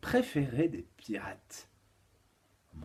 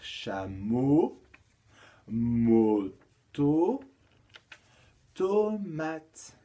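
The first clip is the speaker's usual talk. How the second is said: "chameau, moto, tomate" drawn apart, linking syllables loud; syllables split; 5 dB louder, much slower than usual. Shown as a demonstration. Teacher gives pupils the word order, "chameau, moto, tomate" split with stressed linking syllables. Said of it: "ça devient la première syllabe d'un nouveau mot".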